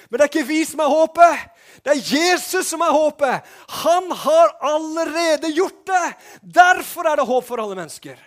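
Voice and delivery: loud, high-pitched preaching; fervent shouted sermon. Real, not acted. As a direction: proclaim hope at full force. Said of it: "Nå roper jeg mye her".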